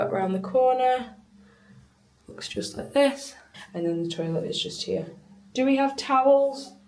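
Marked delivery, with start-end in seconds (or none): 2.3-5.1 soft, breathless whisper to the camera; hushed aside. Acted. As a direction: whisper it like a little secret. Showing the shower on camera.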